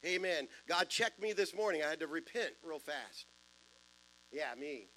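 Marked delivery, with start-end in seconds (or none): none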